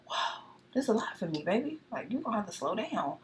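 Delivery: playfully overwhelmed, pitch rising and dipping. Acted. Acting out her overwhelmed reaction.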